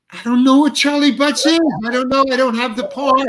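bewildered, high-pitched complaint; exasperated protest. Retold or imitated. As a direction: throw up your hands, voice jumping up. Confused actor protesting to director.

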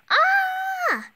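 high loud frightened scream. Acted. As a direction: shriek in fright. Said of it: "Amaya screams".

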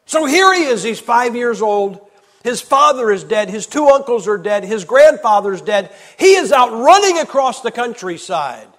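loud, high, slowed pulpit declamation; impassioned preaching. Acted. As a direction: hammer each fact to the crowd. Preaching at full force.

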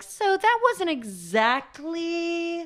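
high, slow, drawn-out diplomatic put-down; imitating a director giving a cutting note, high and slow; mock polite director note. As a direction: deliver a backhanded theater note, slowly. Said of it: "the notes being like".

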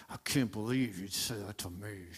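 low, hushed, offended grumble; quietly seethe with wounded pride; puffed-up indignation. Acted. Man stung by a scolding letter, quietly indignant. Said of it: "feel it almost puff up inside".

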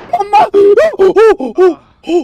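high crying call for mama; real panic; panicked cry. Real, not acted. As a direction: cry out in sudden fright. Panic at falling.

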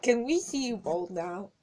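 ordinary talk, nothing marked

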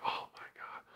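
breathless whisper, barely audible; whispered relief. Retold or imitated. Getting the final stamp at the border, whispered in relief. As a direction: whisper it like you can't believe it.

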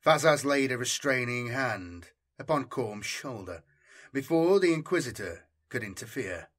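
ordinary delivery, nothing marked

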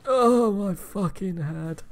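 slow, loud aching complaint; groaning slow mock pain. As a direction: drag it out like a bad headache. Goat crashed, hurt.

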